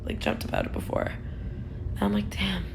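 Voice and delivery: breathy hushed aside; whisper. Real, not acted. To viewers about a vivid dream, whispered. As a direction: let it drop to a whisper.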